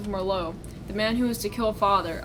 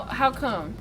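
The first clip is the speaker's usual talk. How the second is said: ordinary delivery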